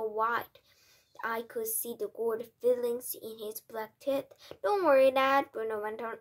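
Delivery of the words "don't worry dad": carefree reassurance. A narrator's reading. Mouse son reassures his father.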